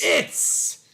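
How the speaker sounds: breathless whispered croak; hoarse It's man croak. Retold or imitated. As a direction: gasp it out like a castaway. Echoing the Python It's man.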